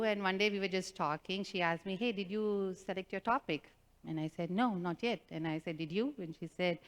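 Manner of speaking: ordinary talk, nothing marked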